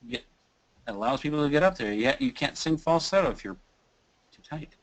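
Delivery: usual speaking voice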